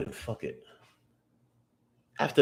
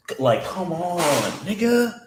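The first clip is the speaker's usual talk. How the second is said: slow come on climbing much higher; excited urging; 10 semitones higher than usual. Real, not acted. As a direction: cheer the chart on, pitch shooting up. Urging the chart on.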